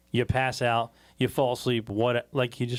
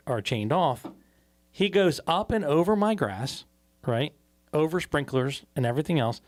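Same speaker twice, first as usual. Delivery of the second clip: ordinary talk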